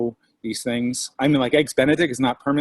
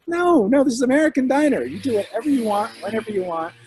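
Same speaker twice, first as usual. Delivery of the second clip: excited insistence; 10 semitones higher than usual. Retold or imitated. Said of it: "And I'm like".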